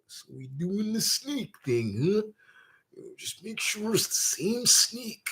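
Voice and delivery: quiet, gravel-free mock Macho drawl; a sly hushed Macho aside; imitating Macho Man. Macho asking Jake about the snake, quiet mock impression. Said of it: "Macho said to Jake".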